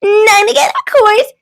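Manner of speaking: high shrieked answer; screaming. Acted. Thistle crowing her answer at Goose, screamed high. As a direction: squeal it out triumphantly. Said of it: "Thistle screamed".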